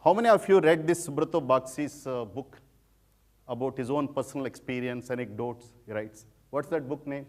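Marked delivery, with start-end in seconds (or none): none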